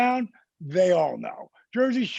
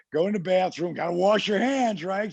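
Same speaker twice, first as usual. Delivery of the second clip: normal talking voice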